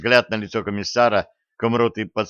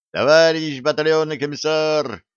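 calling out; 6 semitones higher, 4 dB louder than usual. A narrator's reading. Tanker calls to the commissar from another tank, calling out. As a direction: hail someone from a distance. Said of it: "донеслось от тридцать четвёрки Белякова".

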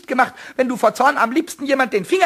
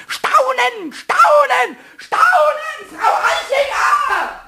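rising higher and louder to a shrill shout; building threat; 11 semitones higher, 4 dB louder, much slower than usual. Acted. Pumuckl vowing revenge on Frau Eichinger, shrill shout. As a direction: hurl the threat at her, louder each time.

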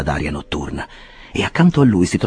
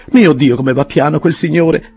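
higher, louder impatient lady; Mrs Rogers exclaiming; 9 semitones higher, 6 dB louder than usual. A narrator's reading. Mrs Rogers fretting at the clerk, higher impatient voice. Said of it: "esclamò la signora Rogers".

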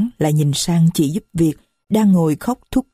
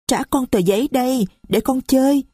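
put-on higher, small voice of a young girl; little girl's voice; 7 semitones higher than usual. Little Marie complains to the stranger, high child voice. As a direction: speak as a little child who is hurt. Marie wants paper back.

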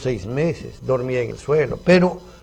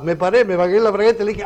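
usual speaking voice

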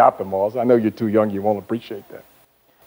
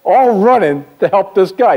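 ordinary talk, nothing marked

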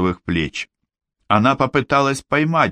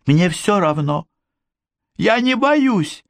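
weak protest; 11 semitones higher than usual. A narrator's reading. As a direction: protest weakly, scared. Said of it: "слабо протестовала она".

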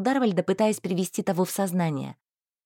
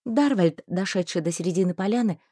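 usual speaking voice

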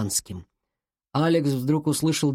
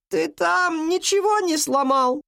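high, slow question from downstairs; granny's drawn-out, high-pitched call; grandmother calling; 13 semitones higher, slower than usual. A narrator's reading. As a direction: holler a check-up through the house. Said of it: "крикнула она".

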